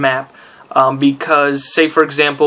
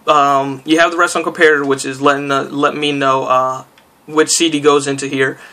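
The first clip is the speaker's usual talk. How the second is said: ordinary talk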